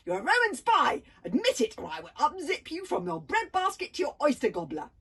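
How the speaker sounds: loud, high threat; threatening shout. A narrator's reading. Girl threatening to gut Hiccup, loud shout. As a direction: shout the threat over the swords.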